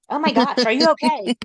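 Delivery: loud, high panicked "are you okay"; alarmed colleague rushing past; panicked concern. Retold or imitated. Coworker panicking outside the office, loud and alarmed.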